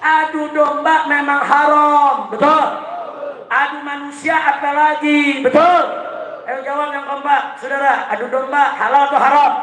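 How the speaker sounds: rabble-rousing list, each phrase pushed high; fiery rally preaching. Real, not acted. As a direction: fire up the crowd, pitched high and sustained. Preacher rallies the crowd.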